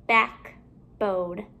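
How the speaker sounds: BACKBONE with a gap between "back" and "bone"; two separate parts. Shown as a demonstration. Teacher building compound words for pupils, halves kept apart.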